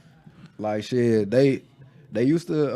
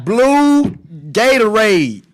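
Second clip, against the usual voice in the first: "Blue Gatorade" much higher and louder, drawn out; near-shouted order; 8 semitones higher, 9 dB louder, much slower than usual. Shown as a demonstration. A last exasperated try at ordering a blue drink from the cashier. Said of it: "say it slow and slower".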